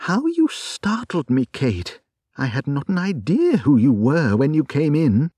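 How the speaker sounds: a hint of a surprised friend; lightly voiced wife recognising Kate; wife's voice. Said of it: "said my wife".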